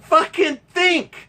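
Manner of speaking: incredulous repetition; indignant emphasis. Real, not acted. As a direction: spit out both words in disbelief. Outraged echo of her demand.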